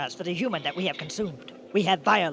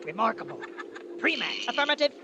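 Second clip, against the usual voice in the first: formal, measured alien voice; stiff alien; 4 semitones higher, 4 dB quieter than usual. Acted. Alien leader questions the human about food, stiff and formal. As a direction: speak like a polite robotic visitor.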